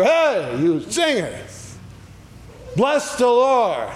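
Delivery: hollering. Retold or imitated. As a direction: call out to them like a cheering crowd. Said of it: "they're hollering over".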